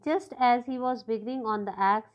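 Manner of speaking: normal talking voice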